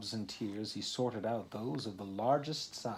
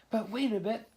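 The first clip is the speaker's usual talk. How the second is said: oysters crying out; 12 semitones higher, 6 dB louder than usual. Acted. Oysters cry out.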